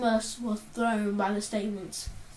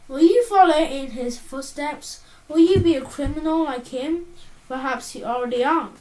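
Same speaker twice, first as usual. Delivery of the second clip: lightly voiced proud doctor, a touch louder; a hint of the fond collector showing off his chair; Doctor Poe, proud; 4 semitones higher, 8 dB louder than usual. Psychiatrist boasting to Artemis of his antique chair, mildly proud.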